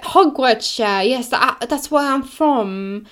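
put-on high, slow, forced English accent; fake posh English accent. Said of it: "She sounds like that".

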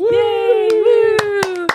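high, loud whooping; celebratory cheer. Real, not acted. Cheering an employee of the month.

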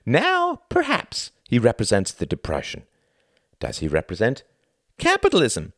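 high, showy stage-host voice; theatrical thin man. Acted. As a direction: play the pompous thin performer lecturing the audience. Thin man explains the metaphor.

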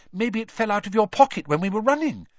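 high, worried suggestion; a touch of anxious Hermione. A narrator's reading. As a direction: fret as you offer the idea. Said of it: "Hermione suggested anxiously".

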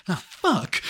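loud, high cry of dismay, retold; mock-dismayed curse, punched hard; caught guy's dismay. Retelling a caught man's reaction to his friend, mock dismay. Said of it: "the guy's like".

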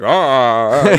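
imitating a friend's odd vocal noise; a loud, drawn-out mock "ah"; Andrew. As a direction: do a weird-talking buddy impression. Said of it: "the way he talks".

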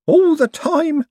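high, anxious little voice; nervous, jittery forger; high fretful Owlswick. A narrator's reading. Owlswick's paranoid line.

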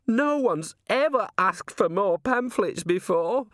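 high, slow acted wail; hammed-up weepy outburst; tearful. A narrator's reading. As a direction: wail it, overcome with grateful tears. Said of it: "burst into tears".

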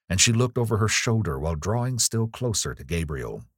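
plain reading voice

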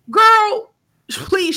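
loud disbelieving call; exasperated. Real, not acted. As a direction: fling it out in disbelief. Outraged retort after mocking quote.

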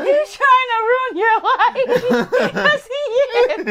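slow, high squeal through laughter; helpless laughter. Real, not acted. Host repeating the joke to co-host, helplessly laughing. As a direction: drag the words out while cracking up.

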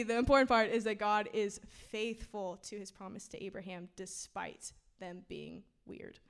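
ordinary delivery

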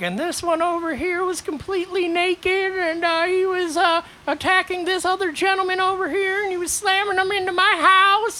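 put-on high prim old lady telling on them; mock high neighbor lady complaining to the police. Neighbor lady reporting to cops.

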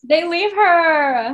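slow, high, whimpering echo; mock crying. Real, not acted. Echoing her co-host's outrage with a slow mock sob. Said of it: "And they fucking leave her".

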